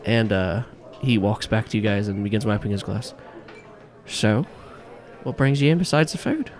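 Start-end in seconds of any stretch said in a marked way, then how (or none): 4.0-6.5 put-on lilting Irish accent, rising on the question; casual Irish barman probing his guests; light Irish brogue; Irish bartender. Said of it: "this strong-built Irishman".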